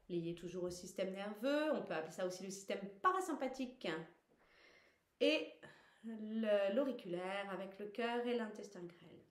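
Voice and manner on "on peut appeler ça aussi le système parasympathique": normal talking voice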